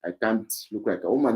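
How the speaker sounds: ordinary talk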